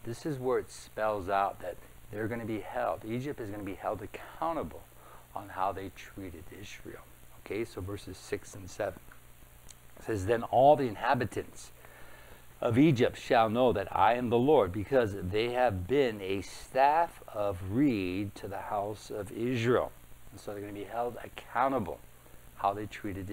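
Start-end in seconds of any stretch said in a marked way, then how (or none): 3.0-7.0 hushed whisper. Acted. Pastor to congregation, whispering the warning. Pastor stresses God's judgment. 10.3-19.9 loud, declaiming preacher reading the prophecy; forceful scripture reading. Acted. Preacher reading Ezekiel to the church, loud and declaiming.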